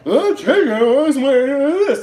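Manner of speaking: loud, high, exaggerated TV narrator; mocking narrator impression. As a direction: mimic an over-emphatic, yelling narrator. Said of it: "That's literally how she fucking talks".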